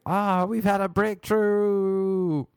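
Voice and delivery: high, slow, dreamy "breakthrough"; mock spiritual teacher. Teacher misreading the outburst as a breakthrough, high slow coo. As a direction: coo it like a delighted guru. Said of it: "She thought I was having some spiritual breakthrough".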